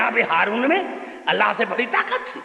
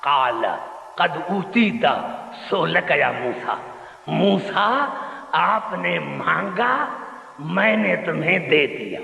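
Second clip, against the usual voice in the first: said with great love; 6 semitones lower, slower than usual. Retold or imitated. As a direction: speak God's words gently, lovingly. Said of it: "بڑے پیار سے کہا". (بہت پیار سے، دھیمی آواز میں)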